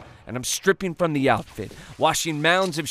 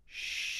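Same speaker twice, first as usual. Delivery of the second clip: long, soft shh; conspiratorial shush; 16 dB quieter than usual. Acted. Fake Santa winks at the little boy, hushing softly. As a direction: hush someone gently to share a secret. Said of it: "and say".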